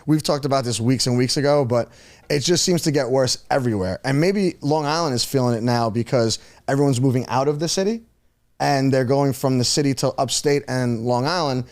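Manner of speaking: ordinary talk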